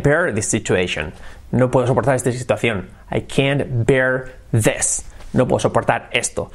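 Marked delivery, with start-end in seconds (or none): none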